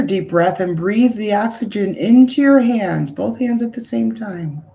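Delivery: calm indoor voice leading breathing; guided meditation. Acted. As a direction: gently guide listeners to breathe into the heart. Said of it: "I'll turn on my indoor voice".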